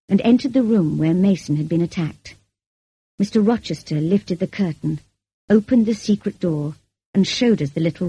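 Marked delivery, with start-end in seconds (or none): none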